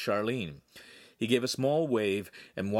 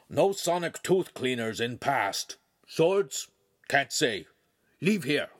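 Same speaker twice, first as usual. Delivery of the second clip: dog AI voice; 3 semitones higher than usual. Acted. Dogface telling Handsome to leave his trunk, curt.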